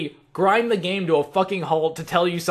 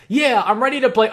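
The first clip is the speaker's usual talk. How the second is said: eager; 7 semitones higher, 7 dB louder than usual. Acted. Acts out himself starting an area.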